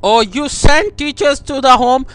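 normal talking voice

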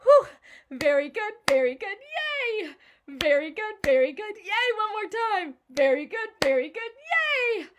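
high, loud praise after laughing; excited cheering. Real, not acted. Laughter teacher cheering her class after the exercise.

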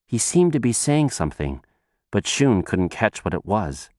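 ordinary delivery

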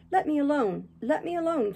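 lightly acted distraught woman, pitched well up; sobbing Catherine. A narrator's reading. Dying Catherine begs her lover to leave her be, mildly sobbing. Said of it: "sobbed Catherine".

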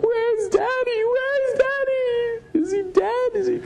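put-on squeaky high little-kid voice, slow and plaintive; tiny child's worried wail; imitating small kids. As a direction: whine it like a toddler missing his dad. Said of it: "the kids are probably like".

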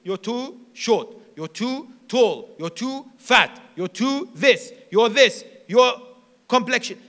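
mildly acted mockery, loud and punchy; mocking. Preacher imitating people who laugh at others, mocking. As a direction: imitate someone jeering at another's looks. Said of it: "and then they laugh at them".

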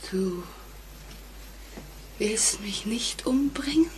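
soft, disbelieving whisper; hushed, shaken question; dazed whisper. Acted. Stunned relief in the hall.

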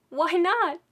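a mother shocked at being told no again; alarmed why not. Retold or imitated. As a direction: demand it in alarm. Mom alarmed at new clerk.

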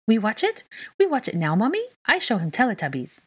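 put-on high, eager small-child begging; pleading child. A narrator's reading. Small child begging Mummy to put television on, pleading. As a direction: plead like a little kid, pitched right up. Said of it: "Aurelian pleaded".

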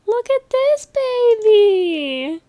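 high, slow cooing over the puppy. Real, not acted. Gushing over a cute dog.

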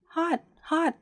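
lightly voiced burnt badger; badger's cry. A narrator's reading. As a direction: yelp as the scalded kettle-badger. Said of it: "it cried".

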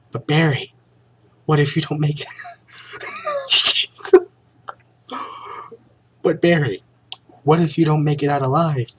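shrill melodramatic plea; overacted damsel in distress, cracking up; hammy stage actor. Vanessa's dialogue, very high and loud.

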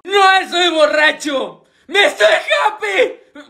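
slow, high-pitched tipsy protest; acting drunk. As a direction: drag it out like a happy drunk. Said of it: "¿Estás borracho, [name]?".

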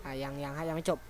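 hushed, breathy aside; whisper. Real, not acted. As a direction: whisper so as not to talk over the game. Aside while game talks. (กระซิบเบาๆ)

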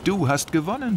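cheerful congrats. Acted.

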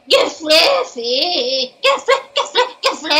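high squeaky chicken Pokemon calling its name. Shown as a demonstration. Voice actor demos a Pokemon saying its name, high squeaky creature cry. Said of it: "Then Gifliffy would say".

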